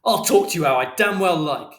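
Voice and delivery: angry shout. A narrator's reading. Father arguing with Mister Shears, shouting back. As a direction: yell back defiantly. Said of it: "And Father shouted".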